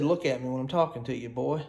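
retold reprimand, low and fast; muttered mock scolding. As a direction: drop low and quiet for a mock telling-off. Quotes own scolding of the device.

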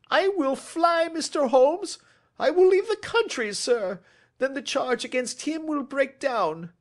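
high, loud begging, easing off at the end; desperate pleading. Acted. Culprit offers to flee the country, pleading desperately. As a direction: offer anything to escape the charge.

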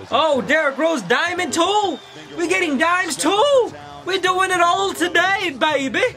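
high, drawn-out hype commentator; smooth-talking highlight caller with long-held endings; Chris Smoove. Acted. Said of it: "That's my Chris Smoove impersonation".

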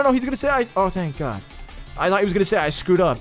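ordinary talk

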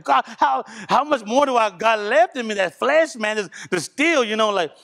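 straining plea. Retold or imitated. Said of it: "I was like".

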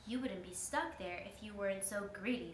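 ordinary delivery

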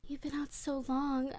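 creepy hushed whisper. Acted. Captor whispers to waking captive, creepy hush. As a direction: breathe it close to his ear.

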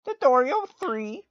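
high, loud announcer read. Pretend. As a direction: announce it like a game show. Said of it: "I don't know why I'm saying it like that".

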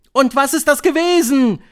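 high, loud shriek of eager witches; shrieked, high-pitched question. A narrator's reading. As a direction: screech the question like an excited audience. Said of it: "kreischten die Zuhörerinnen".

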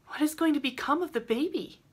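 high-pitched anxious question; worried girl. A narrator's reading. Rilla asks about the orphaned baby, worried and high. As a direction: ask it high, full of concern.

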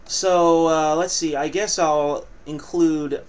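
ordinary talk

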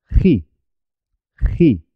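"ji" with a rasped guttural j; from the throat. Shown as a demonstration. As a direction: scrape the j of "ji" in the throat. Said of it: "con la garganta".

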